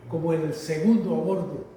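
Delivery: ordinary talk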